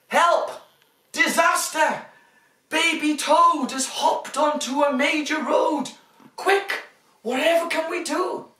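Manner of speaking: high, loud mock alarm; hammed-up panic for small kids; overbright kids party host. Dramatic story reading.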